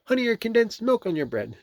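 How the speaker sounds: very high rabbit voice; corrected Rabbit voice. A narrator's reading. Rabbit offering Pooh food, high rabbit voice. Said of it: "I did the wrong voice for that one".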